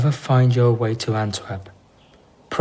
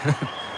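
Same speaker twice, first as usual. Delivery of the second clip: short dismissive laugh; 3 semitones higher, 7 dB quieter than usual. Acted. Amused reply to contempt question.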